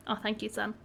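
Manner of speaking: normal talking voice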